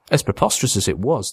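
ordinary delivery, nothing marked

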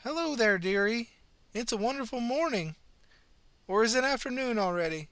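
put-on high, sweet old lady voice; old woman. A narrator's reading. Old pensioner cheerfully greeting the ambulance officer, high and sweet. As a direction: chirp it like a kindly granny.

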